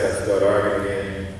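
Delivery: normal talking voice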